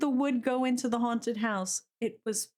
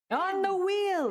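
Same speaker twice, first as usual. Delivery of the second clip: slow showman voice; dramatic announcer; 7 semitones higher, slower than usual. Acted. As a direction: stretch it out like a game show host. Wheel name sung dramatically.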